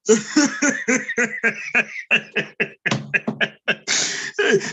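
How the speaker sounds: drawn-out staccato cackling; long laugh. Real, not acted. Comedian cracking up at his own bit, long laugh. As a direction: keep laughing in a long run of bursts.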